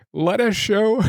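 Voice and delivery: pitched high, choking up mid-sentence; overcome, have to break off; real tears threatening the reading; nearly weeping. Real, not acted. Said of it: "I read it nearly weeping".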